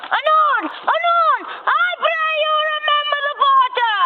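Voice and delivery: high, fast call to the door; a porter calling out to the knockers, high and fast. Acted. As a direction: sing out the answer to the knocking.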